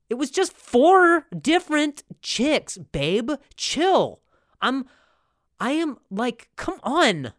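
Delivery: high, drawn-out mock whining; whiny pleading. Boyfriend excusing four different chicks to girlfriend, whiny pleading.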